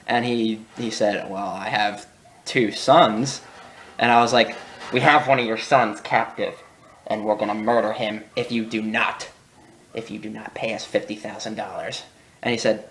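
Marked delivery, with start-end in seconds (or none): 1.3-3.4 slow trucker. Retold or imitated. Trucker answers slowly. 4.9-12.0 low, soft, menacing ransom voice fading lower; mock kidnapper threat. Kid plays kidnapper on radio.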